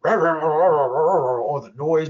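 rumbling mock racket round drawn-out words; loud, stretched-out noise imitation; imitated din. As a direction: play the noise that drowns out speech. Imitates the din the person hears.

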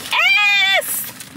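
high squealed yes; excited squeal. Real, not acted. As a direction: squeal it with glee. Excited about mukbang intro.

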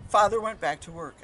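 ordinary delivery

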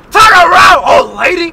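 loud, high shout out the window. Retold or imitated. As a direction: yell it at the driver. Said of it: "I said".

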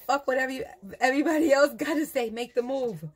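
spirit's bold message. Retold or imitated. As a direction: push it through like a command from beyond. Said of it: "Spirit said".

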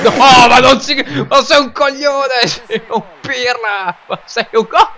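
loud laughing taunts; laughing insults. Real, not acted. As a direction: jeer at him, laughing. Insulting friend after goal.

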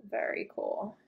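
soft breathy whisper of very cool; hushed, barely voiced admiration. Real, not acted. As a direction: whisper it to yourself in awe. Admiring object in unboxing.

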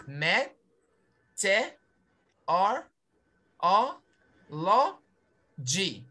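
Me, te, or, o, lo, g each said apart with pauses; syllable by syllable. Shown as a demonstration. Teacher shows the student the six syllables one by one. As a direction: count out each beat. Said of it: "This word has six syllables".

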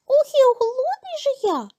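very high, whining mouse voice; high storybook mouse wail; hungry little mouse. A narrator's reading. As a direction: pipe up a big hungry complaint.

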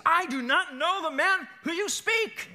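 high, loud, slow outburst; vehement denial. Acted. Peter denying he knows Jesus, loud and vehement. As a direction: hurl out each word at high pitch.

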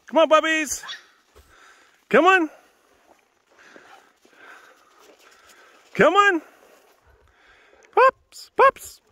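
high, loud call to the dogs; yelling. Real, not acted. Calling puppies to come along on a walk, yelling high. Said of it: "Sorry for yelling".

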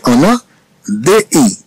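ordinary talk

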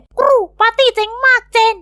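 high squawky parrot blurting; talking parrot. Acted. The parrot Prince blurts out the secret party to Dad, high and squawky. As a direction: squawk it out gleefully like a tattling bird.

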